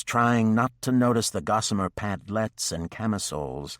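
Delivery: usual speaking voice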